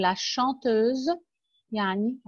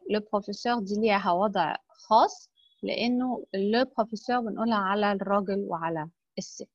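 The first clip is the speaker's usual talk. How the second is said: usual speaking voice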